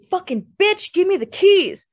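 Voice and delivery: high, loud angry demand; furious scream. A narrator's reading. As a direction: scream it from outside the door. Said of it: "King screamed just outside the door".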